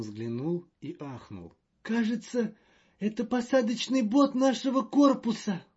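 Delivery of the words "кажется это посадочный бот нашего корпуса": high, loud and fretful; a jittery official in a panic; nervous diplomat voice. Acted. Magnan exclaims.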